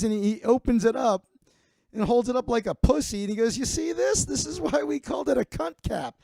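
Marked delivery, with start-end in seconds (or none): 3.6-6.2 high, gleeful punchline voice of a crude uncle; crude uncle joking. Retold or imitated. Uncle showing off the opened hat to the family, high gleeful voice. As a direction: deliver the dirty joke with relish.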